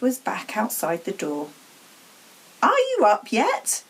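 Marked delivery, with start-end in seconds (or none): 2.6-3.8 high, loud demand; impatient aunt demanding. Acted. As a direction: bark the question through the door. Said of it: "she demanded".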